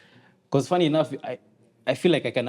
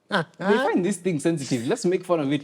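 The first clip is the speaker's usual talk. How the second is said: mischievous; 6 semitones higher than usual. Retold or imitated. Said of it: "I was like".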